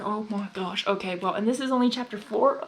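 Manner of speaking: usual speaking voice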